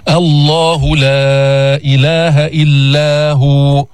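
slow, measured tajweed chanting; Quran recitation. Shown as a demonstration. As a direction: recite carefully as a model for the class. Recites verse.

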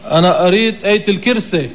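الكرسي said as "il-kirse"; colloquial mispronunciation. Retold or imitated. Teacher quotes some people's wrong wording, colloquial kirse. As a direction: dialect vowels in الكرسي, i first and e at the end. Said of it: "كذلك قول بعض الناس".

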